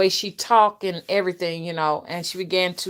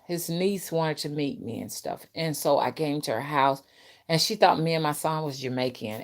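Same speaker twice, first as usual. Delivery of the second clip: ordinary talk, nothing marked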